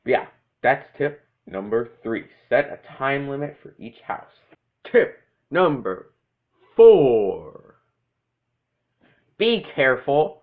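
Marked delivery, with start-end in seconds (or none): 4.8-7.8 slow, then a loud boom on four; spooky announcer. Acted. Tip title with a booming end.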